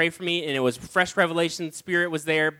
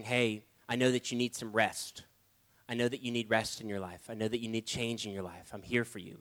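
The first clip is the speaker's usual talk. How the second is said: tender voice of Jesus; 6 semitones lower, 9 dB quieter than usual. Retold or imitated. Jesus speaking through a song, tenderly reassuring. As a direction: reassure softly, like a friend.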